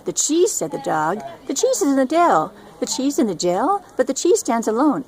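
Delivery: high, eager dog voice; excited dog. Acted. The dog hearing about the cheese, high and eager. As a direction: yap it out eagerly.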